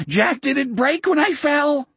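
high, cracking panic; panicked. Acted. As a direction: voice breaking into a scream. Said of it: "his voice cracked toward a scream".

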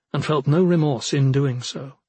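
ordinary delivery, nothing marked

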